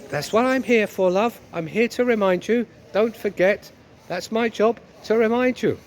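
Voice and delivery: hammed-up wifely insistence; nagging wife, smug. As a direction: play a wife relishing the reminder.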